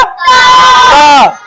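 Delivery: high, loud call of the letter ता; shouted answer. Shown as a demonstration. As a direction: ring out ता for the class. Teacher shouts answer to pupils. (ऊँची आवाज़ में ता पुकारना)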